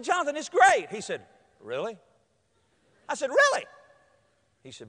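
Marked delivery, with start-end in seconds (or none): 0.0-0.9 higher-pitched upbeat reassurance; encouraging. Retold or imitated. Coach cheering up engaged kid. 1.6-2.0 soft, low questioning Really; doubtful. Retold or imitated. Kid doubts, coach echoes him. 3.3-3.7 high-pitched excited Really; emphatic. Retold or imitated. Coach echoes kid with conviction.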